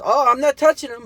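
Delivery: high, loud sing-song denial; mocking impression.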